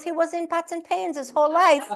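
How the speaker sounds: lightly voiced mother's punchline; mother justifying herself. Mother's punchline in retold story.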